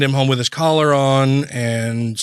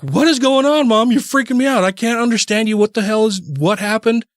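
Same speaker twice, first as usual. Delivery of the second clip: high and louder frightened demanding; panicked demand; 9 semitones higher, 3 dB louder than usual. Retold or imitated. Wife demanding answers from her sobbing mother, high panicked voice. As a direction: near panic, begging for answers.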